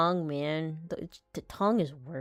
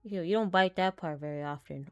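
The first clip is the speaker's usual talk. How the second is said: ordinary talk, nothing marked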